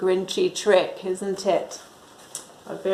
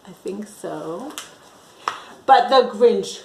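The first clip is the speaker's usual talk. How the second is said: plain reading voice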